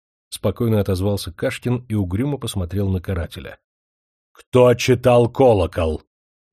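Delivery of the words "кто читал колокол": громкий медленный крик, каждое слово отдельно; сорвись на крик, не сдерживаясь. Acted. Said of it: "не сдерживаясь больше, закричал Астратион". (князь, потеряв терпение, кричит на Кашкина: «Кто читал колокол?»)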